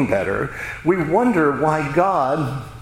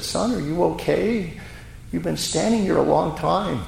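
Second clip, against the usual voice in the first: security guard; 6 semitones higher than usual. Retold or imitated. Security guard addresses staring boy.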